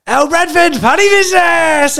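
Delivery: high mocking shout; shouting from the car at a mate waiting for food; yelled out. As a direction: yell it out high to embarrass him. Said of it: "And I yelled out".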